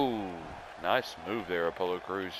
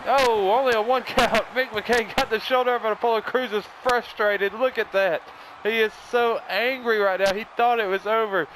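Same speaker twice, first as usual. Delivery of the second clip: high, breathless-with-excitement wrestling commentary; excited play-by-play; 11 semitones higher, 5 dB louder than usual. Pretend. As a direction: call the big slam and near fall at full excitement. Live play-by-play of the match.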